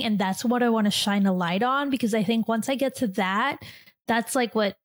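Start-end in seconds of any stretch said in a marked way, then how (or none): none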